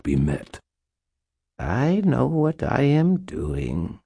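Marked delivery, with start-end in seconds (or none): 1.6-4.0 high, slow purring queen. A narrator's reading. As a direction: purr it like a cat. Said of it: "Mab purred".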